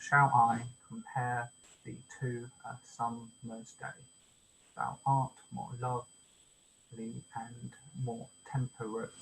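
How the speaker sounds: slow scansion, weak-strong from "Shall I compare" through "temperate"; iambic stress. Shown as a demonstration. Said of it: "so unstressed, stressed".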